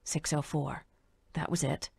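quiet self-reminder; quiet inner check. A narrator's reading. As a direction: say the number softly to yourself. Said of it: "She double-checked her memory".

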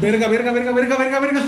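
loud, high, panicked swearing; panicked yelling. Real, not acted. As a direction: yell in real fright. Fright after loud noise.

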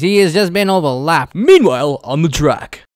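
loud mock sports announcer; race commentator. Gamer mocks a last-place racer like a loud announcer. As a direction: hype it like a race caller.

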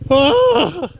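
long, high-pitched "oh"; excited whoop. Real, not acted. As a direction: whoop in amazement. Reacts to the bike flip.

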